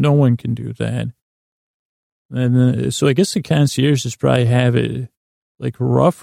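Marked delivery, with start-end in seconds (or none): none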